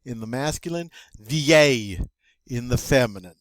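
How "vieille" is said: VIEILLE, loud, ending in a Y glide; no L heard in "vieille", just Y; double L as Y. Shown as a demonstration. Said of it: "double L is pronounced like a Y".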